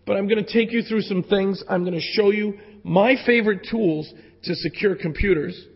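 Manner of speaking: usual speaking voice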